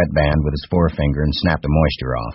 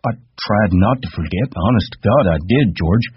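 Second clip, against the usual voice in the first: put-on high, childlike giant voice; Lenny asking George timidly; simple Lenny; 5 semitones higher than usual. A narrator's reading. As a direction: ask like a trusting child.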